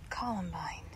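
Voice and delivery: soft whispered plant name. Real, not acted. Naming a flower in the garden, whispered. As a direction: barely breathe the name.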